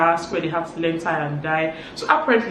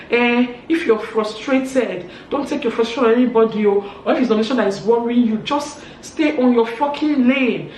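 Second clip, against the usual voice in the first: raised pitch, irritated retort; heated telling-off; 5 semitones higher, 5 dB louder than usual. Retold or imitated. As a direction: act out scolding someone in a quarrel. Acted insult in a retold quarrel.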